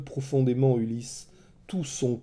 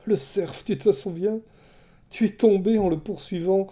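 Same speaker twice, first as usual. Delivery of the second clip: put-on higher, tender, girlish delight; childlike joy; 6 semitones higher than usual. A narrator's reading. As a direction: remember it like a happy child. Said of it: "Un petit rire presque enfantin".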